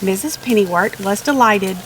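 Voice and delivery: ordinary delivery, nothing marked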